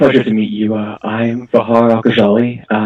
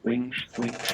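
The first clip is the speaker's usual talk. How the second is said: whisper; 2 semitones higher, 17 dB quieter than usual. Real, not acted. Whispering to Aldia about wings.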